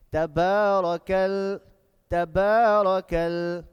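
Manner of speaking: slow, loud recitation with stretched vowels; measured, sustained tajweed delivery; loud Quran recitation. Shown as a demonstration. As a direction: chant it out word by word for a class to repeat. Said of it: "going to do it together".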